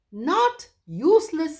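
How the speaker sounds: high, slow, loud owl correction. Acted. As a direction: spell it out for the deaf lord.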